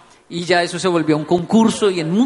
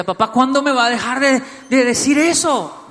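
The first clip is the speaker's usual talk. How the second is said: put-on annoyed child; 5 semitones higher than usual. Son fed up with dad's teasing, imitated annoyed whine. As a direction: imitate a fed-up kid complaining to dad.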